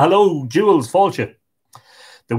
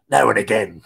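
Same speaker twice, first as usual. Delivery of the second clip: corpse voice; 4 semitones lower than usual. A narrator's reading. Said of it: "says the corpse".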